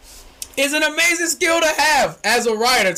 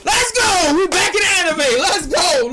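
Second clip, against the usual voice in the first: excited shout; 7 semitones higher than usual. Real, not acted. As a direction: cheer it like a fan. Excited about anime topic.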